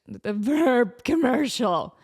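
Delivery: slow, strained cracking voice. Real, not acted. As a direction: let the voice crack, slowly. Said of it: "Like my voice cracked".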